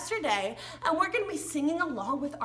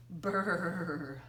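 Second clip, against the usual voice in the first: shivering brr; 8 semitones lower, 5 dB quieter than usual. Acted. Acting cold weather.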